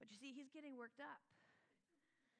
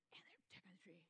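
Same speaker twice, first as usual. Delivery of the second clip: hushed whispered aside; whispered narration of tension; 5 semitones lower, 11 dB quieter, much faster than usual. Real, not acted. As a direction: drop to a whisper. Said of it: "unvoiced whisper".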